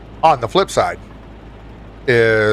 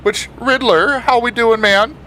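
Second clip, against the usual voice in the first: excited greeting; 9 semitones higher than usual. Real, not acted. Greeting a chatter.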